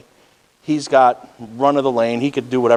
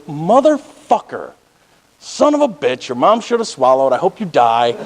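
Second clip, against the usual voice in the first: retold road rage; 3 semitones higher than usual. Driver retelling his outburst at the BMW, pissed off. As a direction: re-enact blowing up at another driver. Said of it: "I was so pissed off".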